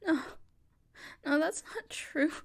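ordinary talk, nothing marked

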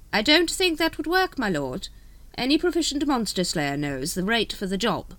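high-pitched, careful courtier; deferential secretary. Acted. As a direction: voice an anxious-to-correct aide, pitched well up.

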